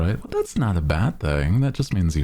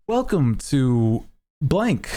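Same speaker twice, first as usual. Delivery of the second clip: customer service voice; 9 semitones higher, slower than usual. Shown as a demonstration. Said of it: "do a customer service voice".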